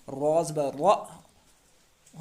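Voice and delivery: very slow, stretched र said thick; teacher drawing out a heavy र; heavy ra drawn out. Shown as a demonstration. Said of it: "इसीलिए रा को हम मोटा पढ़ेंगे".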